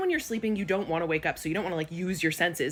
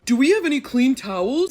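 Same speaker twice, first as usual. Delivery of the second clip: high, louder whiny question; mocking clueless man; 8 semitones higher, 9 dB louder than usual. Wife mocking her husband asking for towels. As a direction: whine it like a helpless husband. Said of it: "you're going".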